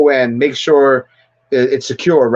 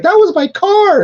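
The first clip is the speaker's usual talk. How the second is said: much higher, squeaky indignant voice; mock whiny Megan; 3 dB louder than usual. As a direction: squeak it out like an offended owner. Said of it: "Megan's like".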